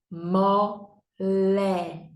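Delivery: Mole split into "mo... le"; syllable by syllable. Shown as a demonstration. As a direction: say Mole slowly in two separate beats. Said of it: "Duas sílabas". (Mole em sílabas: "mo... le")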